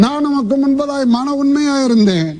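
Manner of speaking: high, pleading prayer of a weeping king; crying king's plea. Retold or imitated. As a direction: plead to God like a king in tears. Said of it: "திரும்பிக் கொண்டு கதறி அழுதான்".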